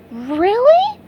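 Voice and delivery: high squeaky SpongeBob voice, amazed; wide-eyed surprise. A narrator's reading. SpongeBob hearing about hockey fans, high amazed squeak. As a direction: chirp it up in wonder. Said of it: "asked SpongeBob, his eyes opening wide".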